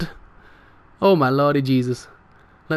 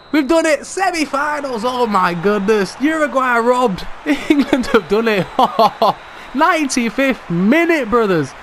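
high, elated victory cry breaking into laughter; overjoyed, laughing with relief; jubilant celebration; 7 semitones higher than usual. Real, not acted. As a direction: celebrate the final whistle in happy disbelief. Final whistle, England go through.

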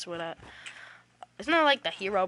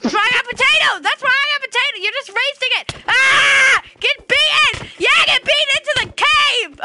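fast, high-pitched screaming taunt; frenzied taunting; 9 dB louder than usual. Pretend. Player beats a friend into the cave, frenzied shouting. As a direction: shriek it high and loud. Mock fight, beating friend in game.

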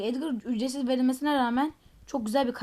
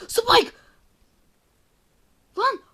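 shrieked high and loud "Spike!"; startled scream; 12 semitones higher, 12 dB louder than usual. Real, not acted. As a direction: scream the name in fright. Scared by the enemy Spike.

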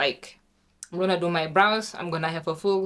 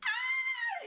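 sudden high breathy gasp of alarm; real startled gasp; sharp high gasp; 8 dB quieter than usual. Real, not acted. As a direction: gasp in shock, high and quiet. Sees flaw in hair.

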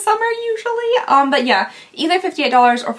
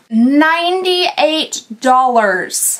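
slow dramatic reveal; 3 dB louder, much slower than usual. Acted.

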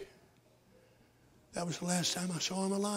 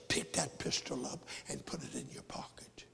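soft, low tempting whisper; the devil whispering a temptation to the husband; devil's whisper; 6 semitones lower, 8 dB quieter than usual. Acted. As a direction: hiss the temptation in his ear. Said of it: "And the devil said".

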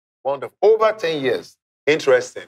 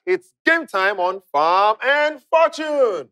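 hyped host shout; 8 semitones higher, slower than usual. Acted. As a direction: sell the opening like a TV host. Game show host opening hype.

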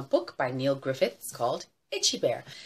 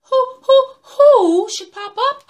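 high, loud hooty owl voice; piercing owl-like who-who; owl hooting; 18 dB louder, slower than usual. Acted. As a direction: hoot the words like an owl. Owl hoot as it appears.

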